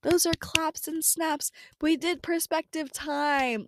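high, slow singsong cheer; self-congratulation. Pretend. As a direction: stretch each word in playful, high-pitched triumph. Congratulates herself with claps and snaps.

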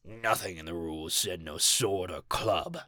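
low, soft sneer. A narrator's reading. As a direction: sneer it quietly, scornfully. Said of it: "sneered one".

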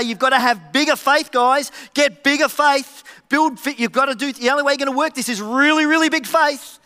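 loud, high mock pep talk; hyped-up exhortation. Imagined demand for bigger faith, loudly hammed-up. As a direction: push it hard, overblown urging.

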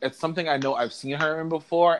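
ordinary delivery

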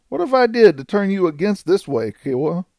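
high-pitched, wounded appeal; baffled outlaw asking a betrayer why; bewildered protest. A narrator's reading. Renegade pleads with supposed friend.

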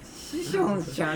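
slow, laughing 「師匠じゃん」, slurred as shishon-shan; imitated slip. Comedian teasing a guest's tongue slip, mimicking 「師匠じゃん」 slowly. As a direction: mimic the fumbled name as 「師匠じゃん」, s blurred into sh. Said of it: "師匠じゃんって言ってましたよ".

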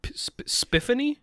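high disbelieving "Spiffany?"; can't believe the name; incredulous. Real, not acted.

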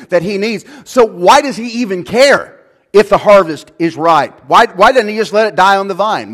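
loud, high, driving sermon voice; preaching at full force. Acted. Pastor to congregation, loud, passionate preaching. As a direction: hammer it home to the congregation.

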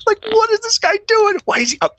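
high, drawn-out disbelief; baffled bosses. Retold or imitated. Police bosses puzzling over the kid up front, high and incredulous. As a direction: sneer like confused brass. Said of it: "they're like".

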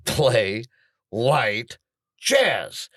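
word-by-word command, pausing between each; frustrated. Retold or imitated. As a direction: spell out the order word by word, rising on the last. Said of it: "I'm starting to get frustrated".

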